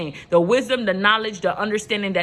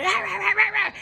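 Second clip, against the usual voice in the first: mock arguing noise; 10 semitones higher than usual. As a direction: playfully mimic a shouting match as "rah, rah, rah". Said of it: "I gotta be the loudest one".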